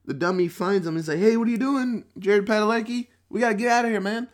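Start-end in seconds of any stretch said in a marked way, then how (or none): 1.2-4.3 high, loud acted urgency; hammed-up, raised-pitch urgent call; urgent alarm. Dummy finds his trapped friend in the movie, urgent alarm.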